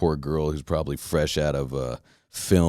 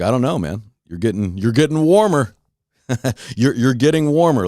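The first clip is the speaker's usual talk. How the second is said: teasing; 5 semitones higher, 4 dB louder than usual. Retold or imitated. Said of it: "I'd be like".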